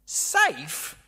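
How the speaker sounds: lisping buck-toothed beaver; a hint of a buck-toothed beaver; Mister Beaver. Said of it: "I'll do my best Mister Beaver impression".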